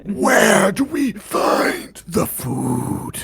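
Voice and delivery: slow, drawn-out creature question. Acted. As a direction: stretch each word like a hungry creature.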